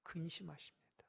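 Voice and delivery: whispered, grieving under the breath; grieved whisper. Acted. Preacher whispering how the Spirit grieves, hushed. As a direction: let the word sink into a sorrowful whisper.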